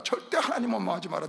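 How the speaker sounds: ordinary talk, nothing marked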